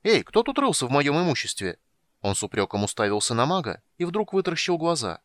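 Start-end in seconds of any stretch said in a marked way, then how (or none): 0.0-1.8 high, quick and chirpy; bright young kender, very high. Acted. Tas voiced high.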